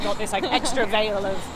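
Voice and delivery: usual speaking voice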